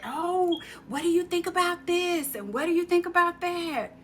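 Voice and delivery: high, sing-song imitation of colleagues; mock sweet asking. Mimics idea-stealing colleagues.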